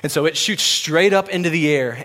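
normal talking voice

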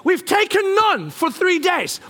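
high, slow, indignant outburst; exasperated retort. Retold or imitated. Dad answering grumbling son, exasperated retort. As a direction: hammer it out in exasperation.

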